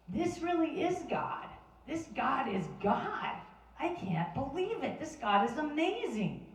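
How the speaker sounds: high, awestruck exclamations; amazed crowd. Retold or imitated. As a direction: cry out in wonder at the miracle. People shouting to God.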